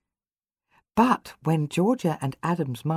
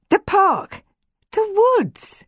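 put-on eager, high children's voices; both blurting out at once; excited; 5 dB louder, much slower than usual.

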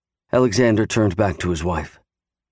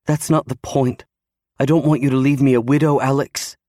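higher-pitched wife, upset and pleading; aggrieved wife pouring out protest; distressed wife; 5 semitones higher than usual. A narrator's reading. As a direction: argue desperately in a higher woman's voice.

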